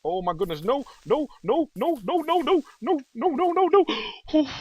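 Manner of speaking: loud, very high screamed pleading; real panic. Real, not acted. Player fleeing a creeper in his own trap, panicked screaming. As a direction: shriek a rising string of frantic cries.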